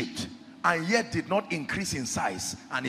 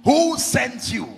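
higher, drawn-out interrogation; demanding challenge; 6 semitones higher, 5 dB louder, much slower than usual. Acted. Pharaoh questions Moses about his sender, demanding challenge. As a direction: press the question slowly, pitched up.

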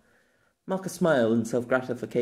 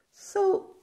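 put-on higher, eager young woman, sharp and clever; Maka. A narrator's reading. Maka quizzing Pierre about being isekai'd, higher and eager. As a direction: voice Maka high and keen as she lays out her theory.

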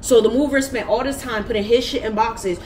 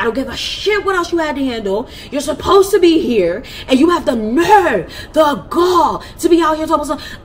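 higher, louder, building rant; screaming; 7 semitones higher, 6 dB louder than usual. Retold or imitated. Yelling at her brother who backtalked her, high and loud. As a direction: lay into him, voice climbing. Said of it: "I was screaming".

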